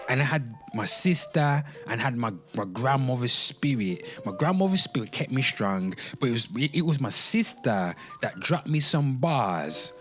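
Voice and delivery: ordinary talk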